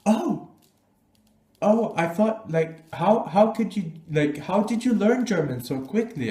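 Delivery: surprised stranger. Retold or imitated. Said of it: "And then he's like".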